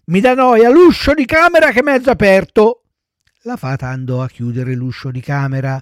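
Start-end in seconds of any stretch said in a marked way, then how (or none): 0.1-2.8 put-on high, loud little-boy refusal; whining child puppet. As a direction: protest like a fussy child. Pinocchio invents another excuse. (burattino lamentoso, voce acuta)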